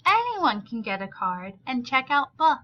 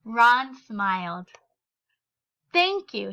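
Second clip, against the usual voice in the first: story reading. A narrator's reading. Teacher reads picture book aloud.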